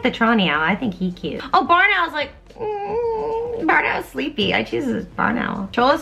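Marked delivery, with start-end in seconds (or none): 1.5-4.5 cooing. Real, not acted. Cooing at cute owl.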